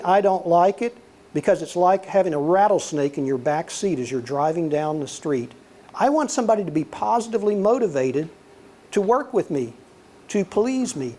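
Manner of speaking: normal talking voice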